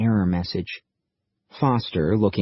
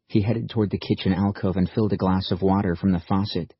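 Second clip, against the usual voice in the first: plain reading voice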